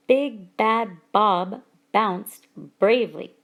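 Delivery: slow "Big Bad Bob bounced bravely", each B punched; stress on B sounds. Shown as a demonstration. Teacher giving an example of alliteration, stress on B sounds. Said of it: "the emphasis is on the B sound".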